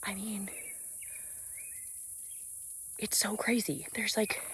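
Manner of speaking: hushed whisper at the crickets. Real, not acted. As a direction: drop to a near whisper, awed. Listening to crickets in nature.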